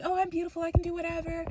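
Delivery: high, prissy sneer; mock vain sister. Mocking the vain sister to the viewers, high and prissy.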